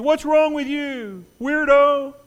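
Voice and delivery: high, loud slow taunt; mocking jeer. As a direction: sneer at the weirdo leaving. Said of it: "And begin to mock you".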